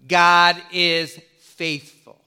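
God is faithful, slow and weighty; slow emphatic declaration. Acted. Preacher to congregation, slow weighty emphasis. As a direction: lean on every word of God is faithful. Preacher stressing key truth.

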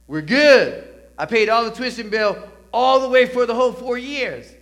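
loud, high triumphant boast; proud shout. Pretend. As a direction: shout it out like you finally made it. Imagined boast of paying tuition.

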